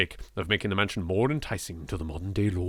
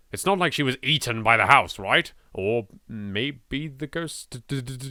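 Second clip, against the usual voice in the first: usual speaking voice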